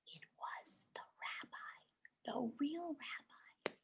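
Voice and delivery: hushed whisper of the twist; whispered reveal. Acted. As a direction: whisper the surprise. Dramatic reveal to children.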